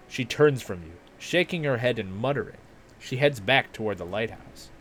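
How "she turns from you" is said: normal talking voice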